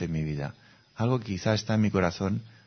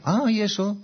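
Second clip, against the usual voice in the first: mock surprise; 13 semitones higher, 5 dB louder than usual. Mock surprised reply to client.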